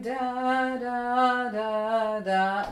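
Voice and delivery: "da, da, da, da" sung with each "da" pushed up and lifted; polka upbeat lilt. Shown as a demonstration. Teacher showing the bow arm's upbeat on "da". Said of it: "bring out that polka upbeat".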